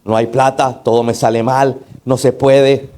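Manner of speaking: low, subdued desperate-man grumble. Acted. Pastor imitating how desperate men cry out, low-voiced mock despair. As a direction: act a desperate man complaining, pitched far down. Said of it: "¿Cómo gritan los hombres cuando están desesperados?".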